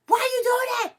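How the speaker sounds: high, loud exasperated yell; a high, loud exasperated "why"; retold yell. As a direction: shout it at people who keep fighting. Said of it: "I'll yell at them".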